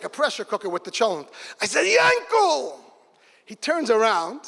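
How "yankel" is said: calls out across the empty grave; calling out. Retold or imitated.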